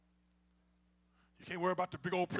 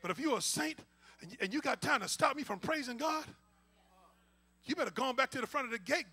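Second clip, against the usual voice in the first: normal talking voice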